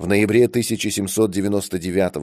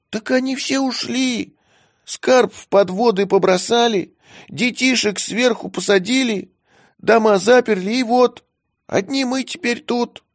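much higher, self-important squeaky voice; proud squeaky kitten; 14 semitones higher than usual. A narrator's reading. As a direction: announce it proudly in a tiny kitten voice. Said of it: "важно сообщил котёнок".

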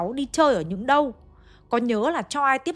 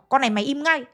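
higher, louder scolding bark; retold angry shout; 5 semitones higher, 3 dB louder than usual. Uncle shouting at the crying mother, a higher, louder scolding. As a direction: snap at her to stop crying. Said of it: "Ông bác thấy thế mới quát".

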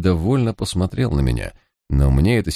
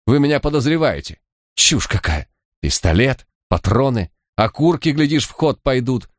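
higher, agitated outburst; shouting; 7 semitones higher than usual. A narrator's reading. Suspect yelling at the detectives, higher and agitated. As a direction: fling the accusation back at them. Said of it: "закричал Груздев".

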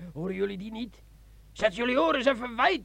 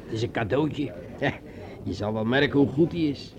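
much lower, sly and intimate; low seductive sergeant; 10 semitones lower than usual. Acted. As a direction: coax her into drinking, low and sly. Sergeant speaks low to Margot. (sergeant fluistert laag en verleidelijk tegen Margot)

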